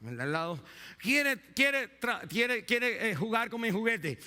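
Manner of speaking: put-on high whiny child's voice; a child running to mum; crying child. Child whining to his mother about a toy, high-pitched. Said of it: "el niño viene llorando".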